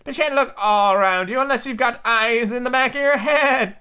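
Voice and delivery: high, wavering sheep voice; bleating sheep. A narrator's reading. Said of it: "the sheep said".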